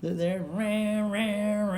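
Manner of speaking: slow, loud droning rah of pipes; mock bagpipes. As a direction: imitate bagpipes marching in. Imitating bagpipes playing.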